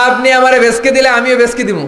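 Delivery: forceful high-pitched declaration; heated preaching. Real, not acted. Preacher raises voice for example.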